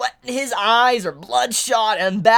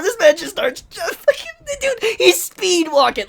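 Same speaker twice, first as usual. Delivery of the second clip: amused disbelief; 8 semitones higher, 4 dB louder than usual. Real, not acted. Laughing disbelief at panel.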